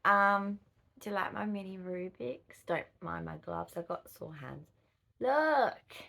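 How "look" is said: excited. Real, not acted. Showing off the mini Rubik's.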